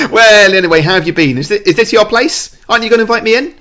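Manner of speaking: loud, high, pushy cockney; James Corden. Acted. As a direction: hyper energy, angling for an invitation. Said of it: "definitely channeling James Corden's, like, hyper energy".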